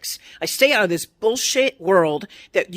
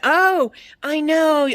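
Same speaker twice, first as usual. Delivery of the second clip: slow, higher, sing-song "oh I know"; mock dismissive agreement; 7 semitones higher, much slower than usual. Mocking people who shrug off her complaints, slow and higher. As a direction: drag it out like you couldn't care less.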